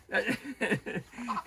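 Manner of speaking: amused little laugh; chuckle. Real, not acted. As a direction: chuckle at your own joke. Teller laughs at own joke.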